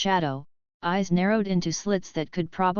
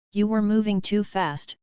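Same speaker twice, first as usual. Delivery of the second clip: usual speaking voice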